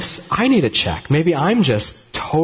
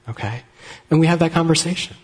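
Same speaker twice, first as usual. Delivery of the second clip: ordinary delivery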